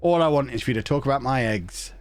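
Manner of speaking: normal talking voice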